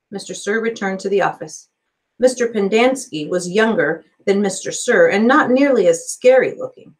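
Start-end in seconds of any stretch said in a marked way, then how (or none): none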